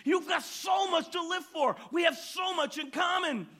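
high, loud, gushing joy; ecstatic preacher. Retold or imitated. Preacher ready to hug the man, high and loud. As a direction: overflow with delight and affection. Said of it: "And the pastor said".

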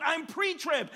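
loud, high declaration; eager answer. Retold or imitated. Man on the cliff answering the preacher, loud and high. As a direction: proudly call it out. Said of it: "And the man said".

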